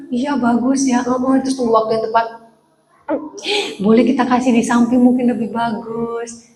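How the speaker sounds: hesitant, lightly voiced diplomatic pastor; tentative, careful suggestion; roundabout politeness. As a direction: hedge politely and hold back the opinion. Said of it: "Ya beda".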